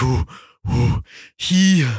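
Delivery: a dragged-out, strained monkey voice; talking monkey voice. Retold or imitated. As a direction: stretch the words out slowly like a monkey learning to talk. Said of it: "That's how the monkey talks".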